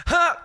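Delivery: retelling the shart on a cruise, loud strained grunt; mock straining grunt. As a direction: fake a sudden strained huff. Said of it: "I just went".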